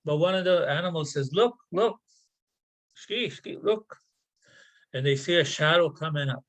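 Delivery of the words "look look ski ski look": high, eager calling out; sudden high excited cry; excited spotting. Acted. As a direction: point and shout with excitement. Animal spots the muskrat surfacing.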